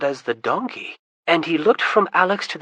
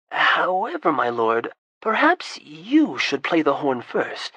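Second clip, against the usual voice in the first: usual speaking voice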